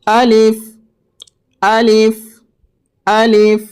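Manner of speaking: আলিফ said short and quick; quick alif. Shown as a demonstration. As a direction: clip each আলিফ short. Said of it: "আলিফটিকে আমাদেরকে তাড়াতাড়ি পড়তে হবে।". (আলিফ ছোট ও তাড়াতাড়ি)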